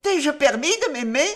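high-pitched tearful question; distraught, tearful protest; in tears. A narrator's reading. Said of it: "interrogea Maria tout en larmes".

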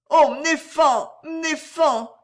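high, slow, moved wail; loud, tremulous-hearted exclamation; emotional cry. A narrator's reading. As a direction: cry out overcome with feeling. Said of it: "cria la voix émue".

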